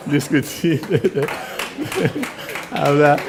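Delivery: real laughter breaking through the word; amused laughter at his own story; laughing. Real, not acted. Laughs at the exchange.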